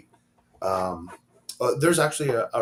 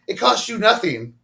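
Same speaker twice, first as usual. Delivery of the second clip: retold exasperated protest; 8 semitones higher, 6 dB louder than usual. Friend retelling how he protested the deleted channel, exasperated. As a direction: recount an indignant plea, pitched up and stressed.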